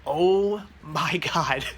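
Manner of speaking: very slow, drawn-out awe; awed. Real, not acted. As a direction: let the wonder out slowly. Walking into the store.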